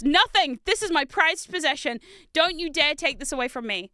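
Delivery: loud, possessive mock outrage; hammed-up defiance; mock indignant. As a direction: refuse dramatically, guarding a treasure. Acts out refusing to sell.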